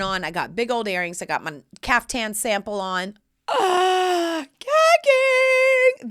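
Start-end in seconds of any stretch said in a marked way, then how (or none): 3.5-6.1 high, loud, thrilled squeal; excited shriek. Real, not acted. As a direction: squeal with delight over the outfit. Excited about own outfit.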